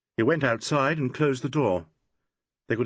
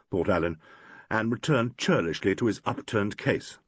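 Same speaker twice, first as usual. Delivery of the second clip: ordinary delivery, nothing marked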